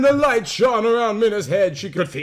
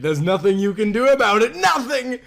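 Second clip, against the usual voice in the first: cold taunt; 5 semitones higher than usual. Acted. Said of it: "Lucy said coldly".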